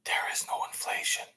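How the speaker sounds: soft breathy mocking whisper; hushed parody of a president's whisper; mock Biden whisper. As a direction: whisper it like a creepy old politician. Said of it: "when he whispers something".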